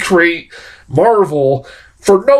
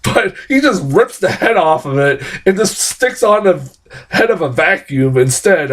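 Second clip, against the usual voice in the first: ordinary talk, nothing marked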